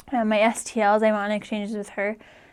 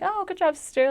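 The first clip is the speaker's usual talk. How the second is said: a breezy, higher throwaway "good job"; casually dismissive quote; offhand mock praise; 9 semitones higher than usual. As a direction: toss it off like it's nothing.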